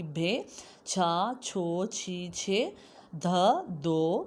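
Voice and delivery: slow, loud, drawn-out syllables for the class; teacher drilling syllables; drilled syllables. Shown as a demonstration. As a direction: sound out each syllable slowly for children. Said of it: "اب ہم نے چار لفظ بنائے ہیں".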